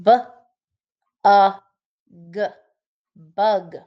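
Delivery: "B, a, g" tapped sound by sound, then "bug" blended. Shown as a demonstration. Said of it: "so you can tap it".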